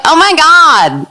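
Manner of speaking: put-on high, slow girly freakout; high mock shriek. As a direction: squeal it in mock panic. Other girls freak out.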